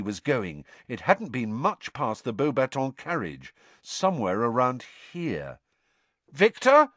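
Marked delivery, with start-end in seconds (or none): 6.3-6.9 high, loud questioning call; raised, searching shout. A narrator's reading. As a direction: call out for someone missing. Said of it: "Harry shouted".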